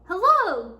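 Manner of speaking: big loud high hello; tortoise shouting a greeting; shouted. A narrator's reading. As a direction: shout it out to be heard. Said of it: "shouted a tortoise".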